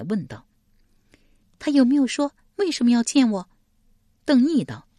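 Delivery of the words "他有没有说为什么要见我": anxious woman, asking. Acted. 史佩 asks after crying.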